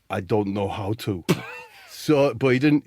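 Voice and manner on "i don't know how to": put-on lower, accented footballer voice; low, deliberate admission in a put-on foreign voice; Zlatan. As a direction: imitate him low and deadpan. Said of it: "He says".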